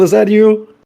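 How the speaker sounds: high, loud surprised call. Acted. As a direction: shout up in surprise.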